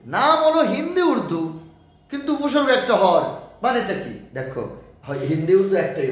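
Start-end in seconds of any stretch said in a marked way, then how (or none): 0.1-4.3 a teacher voicing a pupil's imagined question; imagined student question. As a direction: voice a pupil asking sir a question. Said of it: "প্রশ্ন হতে পারে".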